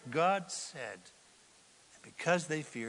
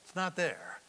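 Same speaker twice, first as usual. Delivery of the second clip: ordinary delivery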